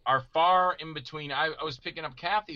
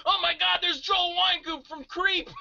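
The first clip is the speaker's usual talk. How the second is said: mock starstruck shout. Actor spotting Joel Wynkoop across the lot, starstruck. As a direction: shriek it like a starstruck fan.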